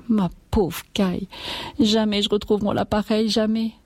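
high, plaintive wail; acted weepy farmwife; tearful lament. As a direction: bemoan an irreplaceable loss. Farmwife lamenting her cow.

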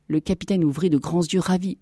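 ordinary delivery

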